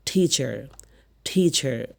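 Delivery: টিচার with air puffed after the T; careful টিচার, T released with breath; aspirated T. Shown as a demonstration. Teacher repeats the word for learners, aspirated T. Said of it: "উচ্চারণ কি বললাম?".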